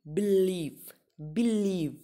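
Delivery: Believe with the stress on लीव; believe as a teacher's example, second syllable stressed. Shown as a demonstration. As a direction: say believe slowly, leaning on the long लीव. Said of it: "वो लीव पे के ऊपर दे रहे हैं".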